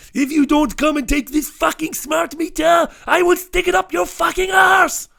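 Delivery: put-on screaming high, loud French accent; hammed-up furious French shriek down the phone; outrageous French accent. Imitating future wife screaming at the meter supplier, outrageous French accent. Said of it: "in a outrageously French accent".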